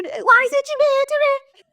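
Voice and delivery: very high, disbelieving cry; incredulous. Retold or imitated. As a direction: squeak it up in outrage. Incredulous mock question.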